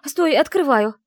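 loud, high yell. Acted. Heroine yelling to the groom about to break the door, loud yell. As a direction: shout it through the door. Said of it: "заорала я".

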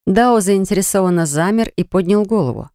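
ordinary delivery

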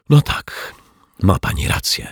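breath-heavy, unvoiced resignation; airy, resigned admission; sighing. A narrator's reading. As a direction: concede with a sigh. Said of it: "westchnął po chwili".

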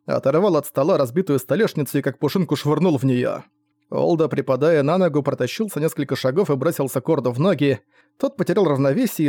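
usual speaking voice

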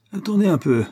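very high, loud warning; worked-up and indignant; overexcited threat. A narrator's reading. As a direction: pipe up in agitation. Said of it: "la surexcitation".

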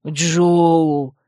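drawn-out, contented brute voice; happy brute. A narrator's reading. Dep learns the girl's name, mildly happy drawl. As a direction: breathe the name out happily. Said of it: "счастливо выдохнул Деп".

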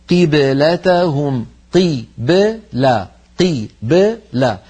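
قبلة with a bouncing still ba, very slowly; قبلتهم with a crisp echoing ba; qalqala demo. Shown as a demonstration. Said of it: "الباء ساكنة". (قلقلة الباء الساكنة ببطء)

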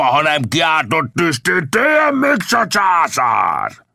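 high, drawn-out bandit tirade; villain bellowing threats and slogans; angry shouted threats. A narrator's reading. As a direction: rant at him, building to a long yell. Bandit keeps shouting threats at Indian.

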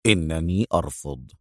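low, soft flat refusal; low, icy refusal; coldly. Acted. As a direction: cut her off, low and cold. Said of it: "في برود".

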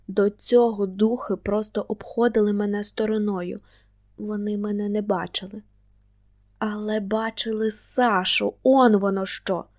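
usual speaking voice

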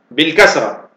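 usual speaking voice

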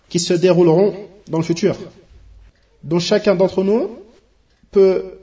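fervent preaching. Acted. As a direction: preach it with growing force to the brothers. Sermon builds to fervent preaching. (prêche de plus en plus fervente)

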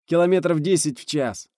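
high, loud, sustained reporting; urgent radio report. A narrator's reading. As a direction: shout the status to base. (громкий срочный доклад)

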